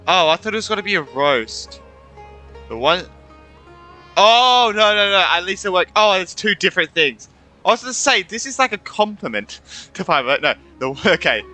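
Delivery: usual speaking voice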